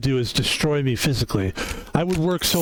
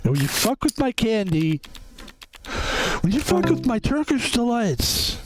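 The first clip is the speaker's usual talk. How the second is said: mock passion; 7 semitones higher than usual. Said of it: "passion runs deep in this guy".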